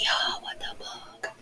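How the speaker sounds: slow shocked whisper; frantic whisper. Real, not acted. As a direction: hush it out in disbelief. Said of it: "I have to whisper because".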